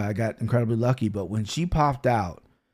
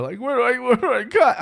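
much higher, panicked rush of a lost new dad; flustered panic; 12 semitones higher than usual. Retold or imitated. New dad at the delivery asking where to cut, high flustered panic. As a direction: squeak it out like you have no idea where to cut.

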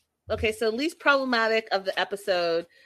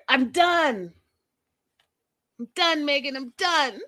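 higher mock of a fed-up man; mocking Ed's line; 7 semitones higher than usual. Host parodying Ed quitting on Megan, higher and theatrical. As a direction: ham up the dramatic breakup line. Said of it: "That's that part".

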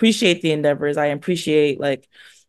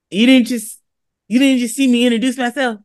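incredulous retort; 5 semitones higher than usual. Retold or imitated. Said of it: "I said".

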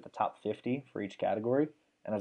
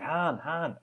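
higher-pitched imitation of calling out a nickname; lightly imitated call, higher than usual; Clifford; 6 semitones higher than usual. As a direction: mimic a teammate calling a name, pitched up. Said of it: "Clifford's like".

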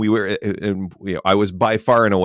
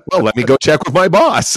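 higher, louder, eager salesman voice; mock salesman; 8 semitones higher, 7 dB louder than usual. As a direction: play the salesman stalling for his boss. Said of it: "he's like".

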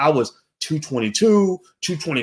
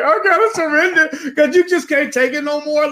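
much higher, strained voice of someone at the end of their rope; desperate churchgoer; 11 semitones higher, 3 dB louder than usual. Retold or imitated. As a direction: cry out that you surrender, pitched way up. Churchgoer giving up at altar.

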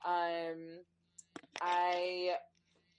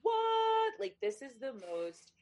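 amazed; 7 semitones higher, 5 dB louder, slower than usual. Retold or imitated. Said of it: "And then I was like".

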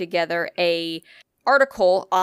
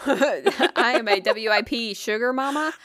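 laughing through it; 3 semitones higher than usual. Real, not acted. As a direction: giggle through the words. Laughing joke.

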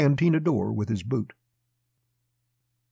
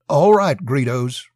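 reverent; 3 semitones higher, 7 dB louder, slower than usual. A narrator's reading. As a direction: agree readily, deferring to the boss. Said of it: "reverence in his tone".

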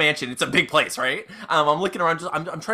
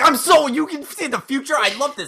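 excited; 9 semitones higher than usual. Acted. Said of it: "I'm like".

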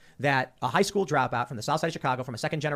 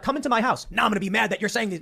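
higher-pitched mock outrage; hammed-up scolding, pitched up; mock indignation; 7 semitones higher than usual. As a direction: act out a touchy, indignant host. Mocking his own angry hosting.

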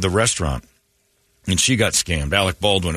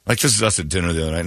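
normal talking voice

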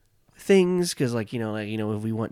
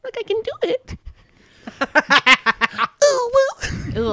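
put-on squeaky high-pitched girl voice; cartoon kid voice; 8 dB louder than usual. Shown as a demonstration. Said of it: "like, a high-pitched girl voice".

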